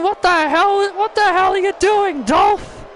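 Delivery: outraged shout. Real, not acted. Outraged at Ziggler again.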